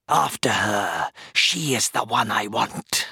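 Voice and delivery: slow, strained scream, held back in volume; villain's scream. A narrator's reading. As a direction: scream the order slowly in a villain's voice. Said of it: "the shade screamed".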